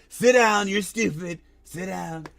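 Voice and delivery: a hint of Trump telling a guy off; lightly voiced Trump impression; imitating Trump. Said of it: "He's like".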